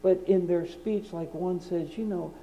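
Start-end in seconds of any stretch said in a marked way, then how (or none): 2.0-2.3 soft, fast, calm mock quote; quietly ripping, mock-gentle; soft-spoken preacher tearing brethren. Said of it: "very calmly and very quietly".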